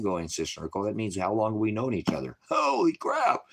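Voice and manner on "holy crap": surprised. Real, not acted. Amazed at how much time passed.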